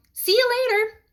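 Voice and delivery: high, playful goodbye; cheeky kid. A narrator's reading. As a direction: toss it off with a grin. Nick grinning, leaving.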